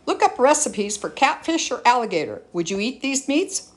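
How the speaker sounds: usual speaking voice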